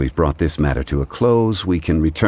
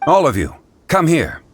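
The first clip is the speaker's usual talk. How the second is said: higher call across the clearing; raised voice calling companions over; 9 semitones higher than usual. Acted. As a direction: summon the group from a distance. Zhongli calls the others over.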